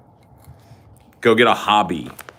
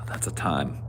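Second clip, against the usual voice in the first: unvoiced whisper of a verdict; whispered deadpan; whispered aside; 5 semitones lower, 9 dB quieter than usual. Real, not acted. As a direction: say it under your breath.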